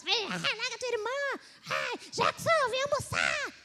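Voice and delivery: put-on mother yelling at the kids. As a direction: play the fed-up mother shouting for Jackson. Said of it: "xinga as crianças".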